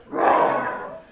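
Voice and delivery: breathy roar like a tiger; mock tiger roar; loud tiger roar. Shown as a demonstration. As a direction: roar it out like a big cat. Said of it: "you're gonna go".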